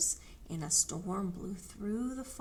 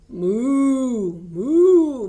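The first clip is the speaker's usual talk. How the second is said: loud, long mooing cow; moaning moo; 4 semitones higher, 15 dB louder than usual. A narrator's reading. Hens moaning a cow's moo. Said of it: "moaned the hens".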